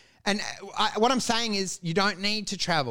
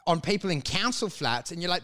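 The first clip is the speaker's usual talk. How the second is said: ordinary talk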